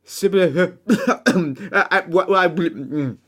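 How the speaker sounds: tongue-tied stammer breaking into nonsense syllables; garbled gibberish. Acted. Cursed rival trying to ask for a kiss, garbled stammer. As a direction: try to speak and get stuck on garbled sounds. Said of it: "as garbled gibberish".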